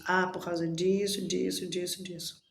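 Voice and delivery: quiet self-answer fading out; fading inner answer. Retold or imitated. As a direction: let the answer trail off to nothing. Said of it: "por que que a outra pessoa fez isso?".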